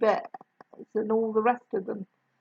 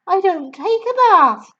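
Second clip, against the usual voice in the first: put-on higher, louder gentle young woman; kind teacher voice; 10 semitones higher, 10 dB louder than usual. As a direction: warm young teacher reassuring. Miss Honey voiced.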